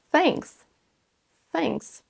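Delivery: thanks with a soft airy th; careful English thanks; correct TH. Shown as a demonstration. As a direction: model thanks, tongue between teeth. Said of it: "la bonne prononciation du son T H".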